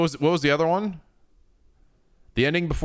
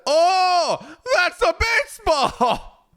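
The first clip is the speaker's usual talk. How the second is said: high, loud, slow announcer yell; video game announcer; 4 dB louder, much slower than usual. Retold or imitated. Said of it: "the video game announcer".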